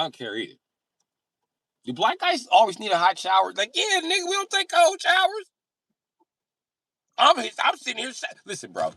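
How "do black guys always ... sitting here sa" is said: high squeaky mock outrage; high-pitched indignation. Comedian answering the friend, squeaking high in mock outrage. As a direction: squeak it out in disbelief.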